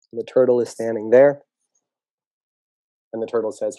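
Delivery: usual speaking voice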